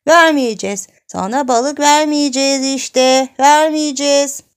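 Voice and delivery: high, loud defiant chant; childish taunting. Acted. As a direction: jeer the refusal from a distance. Çocuk uzaktan nispet yapıyor. (yüksek sesle, çocukça nispet)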